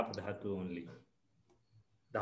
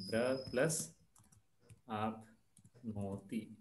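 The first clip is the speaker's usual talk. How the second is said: slow and deliberate; much slower than usual. Shown as a demonstration. Teacher repeats slowly for class.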